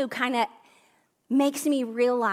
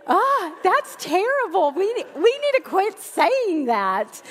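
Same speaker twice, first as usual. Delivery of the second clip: laughing dismay; 7 semitones higher, 5 dB louder than usual. Real, not acted. Laughing reaction to son's line.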